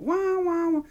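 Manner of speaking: high mock wail wah wah; nervous wailing. Recalling a TV show, mock high wailing. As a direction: imitate his own flustered wailing. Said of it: "my hands were fluttering like a bird".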